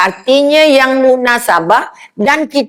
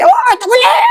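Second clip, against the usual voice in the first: mock shout; 13 semitones higher than usual. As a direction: ham up a shrill overreaction, half laughing. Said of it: "Yang awak nak jerit".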